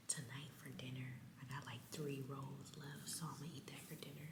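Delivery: hushed vlog whisper; library whisper. Real, not acted. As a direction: keep it down, chatting softly in a library.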